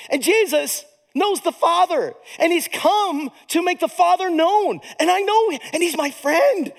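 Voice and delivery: high, fervent declarations with drawn-out endings; impassioned preaching. Real, not acted. Preacher testifying that he knows Jesus, fervent and high. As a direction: proclaim each line with rising wonder.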